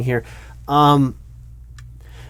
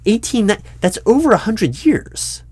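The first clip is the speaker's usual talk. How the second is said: much higher and a bit louder, can't believe the numbers; incredulous; 10 semitones higher, 3 dB louder than usual. Acted. Interviewer working out the candidate's impossible age, incredulous. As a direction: voice climbs in baffled disbelief.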